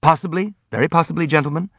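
slightly rushed, anxious assent; hurried. A narrator's reading. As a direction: agree quickly to placate them. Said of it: "the prince said hurriedly".

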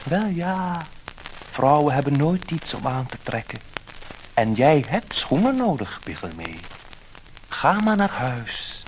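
high fish voice, slowing at the end; magic fish. A narrator's reading. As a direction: grant the wish like a gentle magic fish. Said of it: "het visje antwoordde".

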